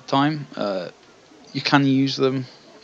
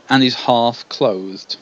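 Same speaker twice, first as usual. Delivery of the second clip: usual speaking voice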